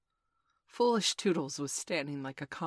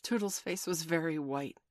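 plain reading voice

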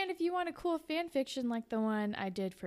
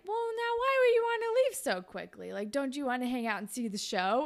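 flirty Jay; 3 semitones higher, 4 dB louder than usual. Acted. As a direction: lay on the charm like a sleazy guy at a street race. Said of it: "he's like".

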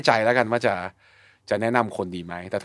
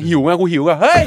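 retold anger; 5 semitones higher, 7 dB louder than usual. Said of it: "ผมยังเคยโมโหเลย".

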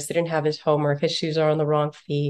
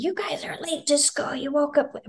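high, sharp mocking mom voice; scolding mom; 10 semitones higher than usual. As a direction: mimic a frantic scolding mother. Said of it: "mom would get up".

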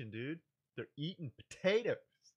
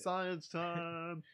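announcer voice; 5 semitones higher than usual. Acted. Announcing a show segment.

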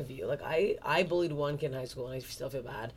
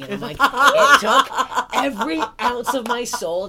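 loud, higher laughing narration; 6 semitones higher, 13 dB louder, slower than usual. Real, not acted. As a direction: laugh through the telling.

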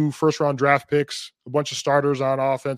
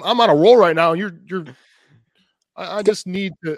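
laughing while insisting, a little louder; laughing plea to keep the floor; laughing protest; 4 semitones higher, 7 dB louder than usual. Real, not acted. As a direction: push through the laugh to keep talking.

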